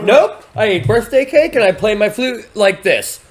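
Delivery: high, loud retold denial; mock insistent denial. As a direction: imitate a suspect protesting innocence. Acted denial under questioning.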